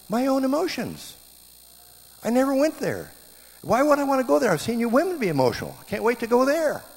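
high, loud theatrical complaint; acted outcry against getting emotional; mock indignant protest. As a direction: protest at full pitch, hammed up.

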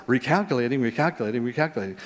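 low, soft repeated sat-nav prompt; GPS voice. Retold or imitated. Little thing pops up on GPS, low repeated announcement. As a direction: mimic a navigation system on a loop. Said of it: "this little thing that comes up on it and says".

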